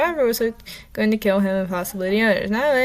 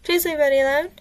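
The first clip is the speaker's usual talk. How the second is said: yelling; 7 semitones higher than usual. Acted. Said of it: "Emmy yelled".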